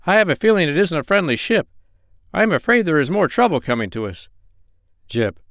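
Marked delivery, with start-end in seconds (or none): 0.1-4.3 higher-pitched, uneasy animal character; high-pitched worried storybook voice; wary Dab-Dab. Acted. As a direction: voice a suspicious companion, pitched up.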